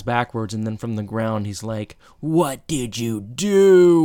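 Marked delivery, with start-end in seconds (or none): 2.2-4.1 slow, loud, raised-pitch mock outrage; mock accusing yell. Eric yells at his brother from the ground, mock outrage. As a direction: draw out each word in hammed-up anger. Retold angry yell from the floor.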